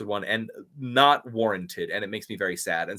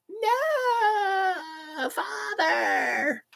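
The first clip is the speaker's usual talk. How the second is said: long high wailing plea; hammed-up scream for help, drawn out; mock agonized cry; much slower than usual. Acting out Luke begging Father, mock agony. Voicing Luke in reenacted scene.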